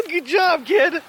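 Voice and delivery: high, loud praise broken by laughter; laughing praise. Real, not acted. Still laughing at the dog.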